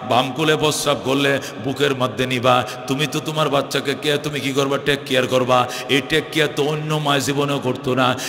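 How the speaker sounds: low, steady chanted counsel; gently reasoning Prophet. Retold or imitated. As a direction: intone the advice low and evenly.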